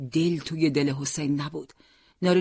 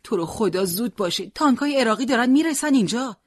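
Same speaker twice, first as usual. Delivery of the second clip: pleading, climbing high and louder; hurried, urgent; 7 semitones higher, 4 dB louder than usual. A narrator's reading. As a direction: beg them to hurry, building to alarm. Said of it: "حسین شتاب زده گفت".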